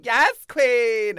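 loud, high sassy "Yes, queen!"; mock young queen. Young queen gushing at the elders, loud and high. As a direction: camp it up like an eager baby queen. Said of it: "they're going".

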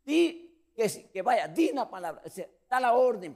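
high, loud urgent demand for a word; eager plea. Retold or imitated. Peter urging Jesus to give the order, high loud plea. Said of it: "Jesús dice".

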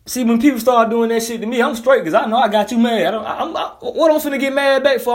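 ordinary delivery

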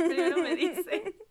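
laughing so hard the words wobble; giggling, slow and high. Real, not acted. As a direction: barely get it out for laughing. Cracking up telling pick-up lines.

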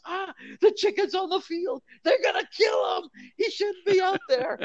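high, loud mock cry of alarm; panicked fans. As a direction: cry out like a horrified fan in the stands. Fans panicking at chicken on field.

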